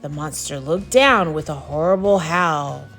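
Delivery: ordinary delivery, nothing marked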